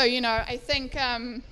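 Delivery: ordinary delivery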